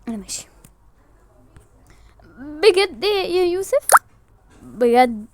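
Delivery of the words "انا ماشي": sulky whisper. Acted. Sulky exit.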